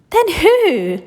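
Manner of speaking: high loud eager cry; cried. Acted. Charlie asking Grandpa Joe, high loud cry. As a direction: cry out for the answer. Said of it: "cried Charlie".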